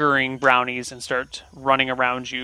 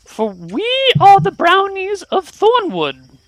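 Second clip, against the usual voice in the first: high, indignant tiny fey voice; offended brownie; 5 dB louder than usual. Acted. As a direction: huff it out like a proud little creature.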